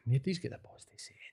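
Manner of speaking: a fast breathy aside under the breath; quick whisper. Real, not acted. To the host about a client who won't complain, a fast whisper. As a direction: hush it out fast, as if someone might hear.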